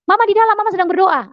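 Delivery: lightly imitated child, high pitch; child's reply. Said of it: "Anak saya bilang gitu". (menirukan jawaban anak dengan nada tinggi)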